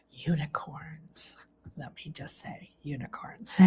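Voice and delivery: hushed, secret whisper; soft whispered awe; awed whisper. Real, not acted. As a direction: breathe it out like a reverent secret. Awed reveal of the unicorns.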